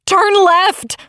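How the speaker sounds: high sharp order; screeching. A narrator's reading. Crystal screeches directions to driver, high screech. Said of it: "Crystal screeches".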